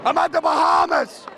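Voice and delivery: hyped-up shouting announcer; monster truck ad. Acted. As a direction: sell it like an arena ad. Crowd hype after countdown.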